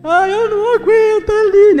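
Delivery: crying out. Retold or imitated. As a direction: let it burst out in sobs. Friend breaking down crying.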